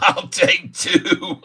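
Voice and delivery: high and slow, squeezed out through laughter; real laugh under the words. Real, not acted. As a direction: barely get it out for giggling. Laughing at a chat joke.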